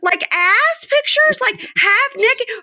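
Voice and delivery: voicing Joy outraged. Podcaster as Joy shrieking at Red about pictures. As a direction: shriek it out like a jealous girlfriend.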